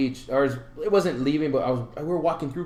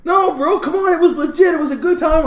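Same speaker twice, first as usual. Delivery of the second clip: high loud insistent protest; earnest insistence; 8 dB louder than usual. Retold or imitated. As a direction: insist it was real. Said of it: "And he's like".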